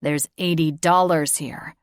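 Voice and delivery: loud, clenched, every word hit; each word emphasized. A narrator's reading. Father lays out the evidence, each word stressed. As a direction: drop each word like a bomb. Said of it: "he emphasized each word".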